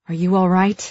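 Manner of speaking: plain reading voice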